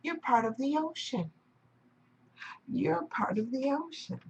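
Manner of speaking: reassuring wise wave. Acted. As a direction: gently settle a frightened friend. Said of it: "He was like".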